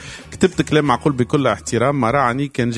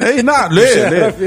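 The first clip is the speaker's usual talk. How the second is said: laughing; 8 semitones higher, 6 dB louder, slower than usual. Real, not acted. Laughs after mic trouble.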